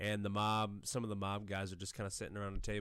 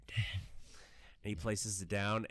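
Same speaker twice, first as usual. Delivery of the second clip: ordinary talk, nothing marked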